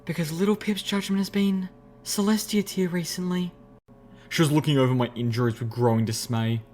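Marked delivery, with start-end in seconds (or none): 0.1-3.5 high ladylike voice, sighing; Velvet. A narrator's reading. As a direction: deliver it archly in a high, feminine voice. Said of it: "Velvet said in a drooling yet ladylike sigh".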